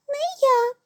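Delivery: high squeaky refusal; tiny mouse shrugging it off. A narrator's reading. Mouse refuses the rooster's request, high squeak.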